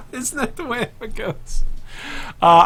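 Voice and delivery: high laughing remark. Real, not acted. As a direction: laugh through the words. Laughing at own mistake.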